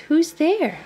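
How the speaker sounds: high, startled little mouse; mouse crying out. Acted. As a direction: call out like a nervous mouse. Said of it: "Mouse cries".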